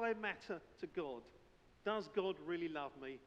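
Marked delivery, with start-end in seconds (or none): none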